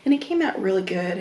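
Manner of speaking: ordinary talk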